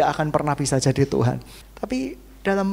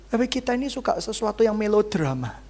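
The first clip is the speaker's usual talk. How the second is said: ordinary delivery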